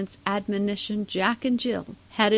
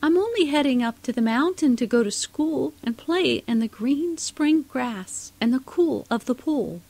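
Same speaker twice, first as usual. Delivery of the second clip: put-on higher, louder small child; little girl voice; 6 semitones higher, 4 dB louder than usual. A narrator's reading. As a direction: chirp it like a brave little girl. Smallest kid answers troll.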